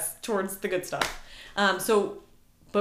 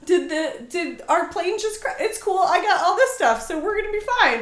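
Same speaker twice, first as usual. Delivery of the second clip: unbothered cartoon kid; 8 semitones higher than usual. Describing Milo Murphy to the co-host, a lightly voiced carefree kid. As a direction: shrug off a plane crash like it's nothing. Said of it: "He's like".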